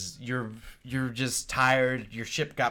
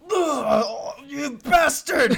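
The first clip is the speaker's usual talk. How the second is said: high, loud, slow outraged villain cry; hammy betrayed villain howl; mustache twirling villain; 10 dB louder, much slower than usual. Acted. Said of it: "he's like".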